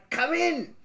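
calling elves. Acted. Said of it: "They cried".

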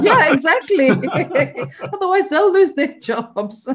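high, loud, through real laughter; laughing agreement. Real, not acted. Cohost agrees with guest's joke about keeping staff employed, laughing. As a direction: agree while laughing, pitch leaping up.

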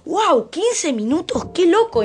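amazed. Retold or imitated. Surprised at time passed.

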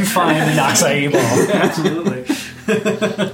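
laughing while granting it. Real, not acted. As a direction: laugh through the words. GM laughing at the request.

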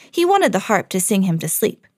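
usual speaking voice

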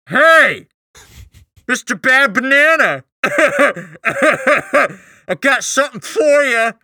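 high, drawn-out taunting holler; calling out. Acted. Plotter luring the Grinch from his lair, calling out high. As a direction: holler it up high to lure someone out, half laughing. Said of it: "We draw him out by calling out".

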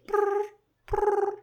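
high rolled brrr like a ringing phone; a parrot mimicking a phone; high trilled phone buzz. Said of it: "a bird doing a phone".

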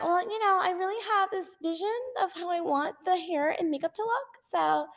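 lightly voiced bride, high and dreamy; mock bride voice. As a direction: imitate the bride gushing about her vision. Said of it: "she might be like".

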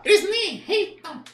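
high, loud, slow puppet voice; grumpy high-pitched dummy; Kleber. Acted. Puppet complaining about the staff, high puppet voice. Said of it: "acha uma voz que pareça com o boneco".

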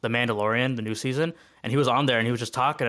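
usual speaking voice

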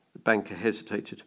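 ordinary delivery

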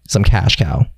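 ordinary talk, nothing marked

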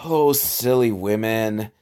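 usual speaking voice

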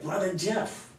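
high-pitched imitation of a friend's accent; Dan's accent. Church volunteer greeting his pastor, imitated accent. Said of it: "he had an accent like this".

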